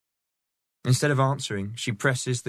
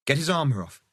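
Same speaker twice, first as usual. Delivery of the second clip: firm higher command; ordering; 6 semitones higher, faster than usual. Acted. Catherine ordering the man-at-arms, firm command. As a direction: give the order crisply. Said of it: "she orders".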